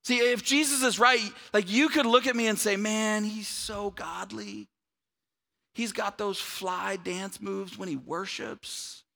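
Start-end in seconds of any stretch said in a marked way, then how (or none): 2.8-9.0 lightly hammed-up gushing, slowly drawn out; mock admiration. Preacher imitating people who admire him, mock-impressed. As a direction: stretch the praise with tongue in cheek. Voices admirers of himself, tongue in cheek.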